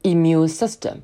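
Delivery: "immune" starting with a plain "ee" sound, not "in"; slow "immune system", open ee at the start; clear i vowel. Shown as a demonstration. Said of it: "Try to follow with me". ("immune"开头读成E的音，不是in)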